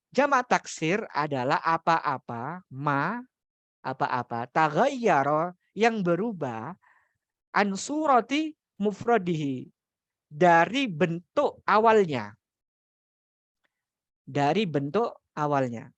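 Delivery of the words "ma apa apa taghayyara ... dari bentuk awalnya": teacher stressing the key phrase slowly; slow emphasis. Shown as a demonstration. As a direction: lean into dari bentuk awalnya so it sticks.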